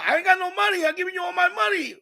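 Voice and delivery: father yelling that it's not his problem; mock shouting father. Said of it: "because he just told her".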